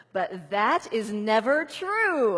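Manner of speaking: loud, slow, emphatic scolding; a playful scold to the doubts; finger-wagging declaration. Shown as a demonstration. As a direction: wag your finger and declare it loudly. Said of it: "shake your finger".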